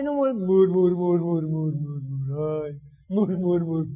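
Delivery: mock the grumbling with falling "mur-"; murmuring sound. Shown as a demonstration. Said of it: "No quejarte". ("mur-, mur-" burlón, bajando de tono)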